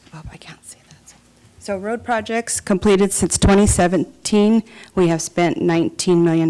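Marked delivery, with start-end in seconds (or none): 0.1-1.1 whispered aside. Real, not acted. Muttered aside off-mic.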